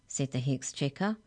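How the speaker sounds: usual speaking voice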